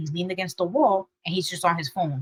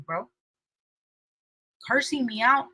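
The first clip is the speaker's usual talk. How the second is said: usual speaking voice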